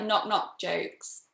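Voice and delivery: usual speaking voice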